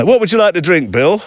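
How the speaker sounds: lightly voiced pub chat; asking a mate his drink in a pub; pub small talk. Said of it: "Can you imagine".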